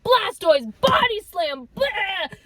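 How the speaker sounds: playful Pokemon move shout; mock Pokemon attack call. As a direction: call it like a cartoon attack then gag. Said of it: "like a Pokemon".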